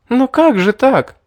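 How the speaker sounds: расплакалась. A narrator's reading. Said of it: "расплакалась девушка".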